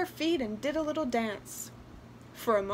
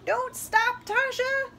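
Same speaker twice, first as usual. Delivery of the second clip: high, loud doll's cry; 12 semitones higher, 5 dB louder than usual. A narrator's reading. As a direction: cry out insistently as a little doll. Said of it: "cried the doll".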